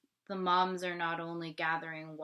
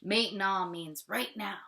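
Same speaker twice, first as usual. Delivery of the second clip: usual speaking voice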